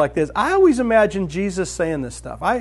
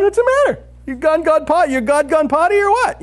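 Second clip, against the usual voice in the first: mocking Elijah; 9 semitones higher, 4 dB louder than usual. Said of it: "Elijah's just sitting there going".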